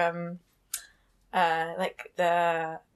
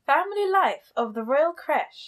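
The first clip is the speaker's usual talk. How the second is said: slow, higher put-on posh voice; posh woman; 4 semitones higher than usual. Podcast host restarting the chapter quote, mock-posh woman. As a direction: draw it out like a posh lady reading aloud. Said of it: "reading it as a posh woman".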